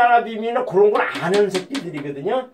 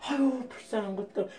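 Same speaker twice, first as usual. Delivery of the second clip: much softer sigh of pity; 2 semitones higher, 12 dB quieter than usual. Acted. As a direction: breathe it out with soft pity. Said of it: "불쌍하다 그래요".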